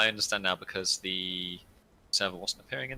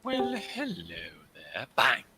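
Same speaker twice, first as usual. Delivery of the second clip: mock skeleton greeting; slower than usual. Skeleton greeting a respawned player, playfully menacing. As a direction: lure them sweetly, then pounce. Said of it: "what I'd do if I was a skeleton".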